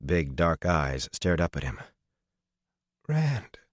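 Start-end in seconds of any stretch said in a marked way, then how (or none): 3.1-3.6 breathed. Acted. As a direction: breathe his name in relief. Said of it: "she breathed".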